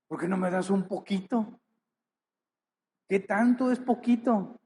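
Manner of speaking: mock whiny plea, rising; imitated complaint to God. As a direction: mimic a believer begging for a little. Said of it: "Y preguntas".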